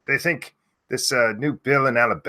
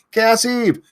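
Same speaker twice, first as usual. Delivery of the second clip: screaming a name; 13 semitones higher than usual. Real, not acted. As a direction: yell the name out high. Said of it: "If you want me to scream your name".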